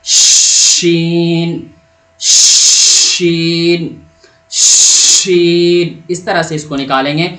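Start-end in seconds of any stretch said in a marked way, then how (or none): 0.0-6.0 شین held long, loud rushing sh with teeth apart; شین slow and louder, a long hushing sh; airy drawn-out sheen. Shown as a demonstration. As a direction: let air stream out on the sh of شین. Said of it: "تو کیسے؟".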